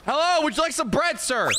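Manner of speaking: high-pitched, chirpy sales greeting; eager shopkeeper. Pretend. Shopkeeper offering bread to a customer, eager high voice. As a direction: play a clerk pitching up to a customer.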